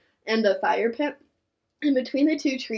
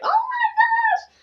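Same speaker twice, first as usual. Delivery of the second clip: very high, slow squeal; excited squeal; 4 dB louder, much slower than usual. Retold or imitated. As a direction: stretch it out in a delighted squeal. Reacting to proposal.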